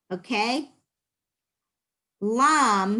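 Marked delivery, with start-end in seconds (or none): 2.2-3.0 staccato. Shown as a demonstration. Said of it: "let's do it again".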